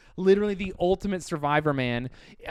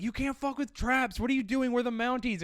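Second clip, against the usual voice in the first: mock yelling; 8 semitones higher than usual. Mounties shouting at the trapper, higher-pitched mock yell. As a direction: yell it up high like an indignant cop, half-joking. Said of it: "yelling at him, like".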